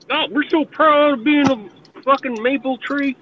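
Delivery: high, sing-song mock pride; mocking Canadians. Mocking Canadians to his chat friends, high sarcastic voice. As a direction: send up their maple tree pride.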